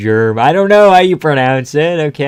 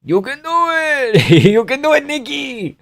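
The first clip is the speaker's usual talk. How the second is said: high strained impression; 12 semitones higher than usual. Streamer cheering in a strained Merab voice, high. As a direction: push it high and draw it out.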